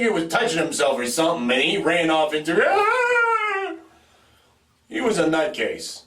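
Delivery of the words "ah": long, high, held wail; imitated scream. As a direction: mimic a kid shrieking as he runs off. Larry ran off screaming.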